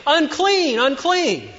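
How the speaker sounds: high, loud warning call; calling out unclean. Acted. Leper walking into a market, high loud cry. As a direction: announce it to the whole store. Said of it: "as you open the door and saying".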